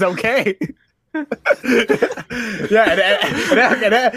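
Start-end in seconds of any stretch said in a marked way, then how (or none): none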